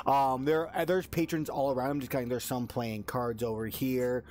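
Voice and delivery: ordinary delivery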